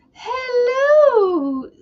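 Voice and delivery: high, drawn-out falling hello; Mrs. Doubtfire hello. Retold or imitated. Quoting Mrs. Doubtfire from the movie, high falsetto hello. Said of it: "he goes".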